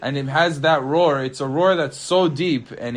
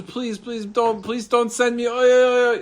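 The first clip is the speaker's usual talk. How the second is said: tearful pleading; 7 semitones higher than usual. Acted. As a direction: beg in a high, whimpering donkey's panic. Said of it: "the donkey starts crying before Shlomo".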